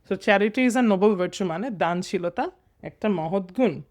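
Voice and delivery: usual speaking voice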